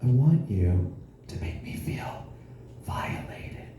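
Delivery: hushed, voiceless mock-seductive whisper; imitated whisper. Comedian imitating his partner being kinky in bed, whispering. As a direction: whisper it slowly, trying to sound kinky. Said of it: "she whispers to me".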